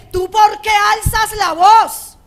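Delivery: loud, high, drawn-out parental demand. Acted. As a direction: scold the child at top volume, dragging the words. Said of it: "Si se habla duro, él habla duro".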